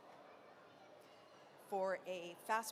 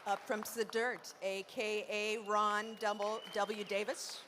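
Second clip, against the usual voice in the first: loud, raised-pitch introductions; announcer roll call; 3 semitones higher, 3 dB louder than usual. Acted. Host introducing poets to the festival crowd, announcer roll call. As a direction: call each name out to a crowd.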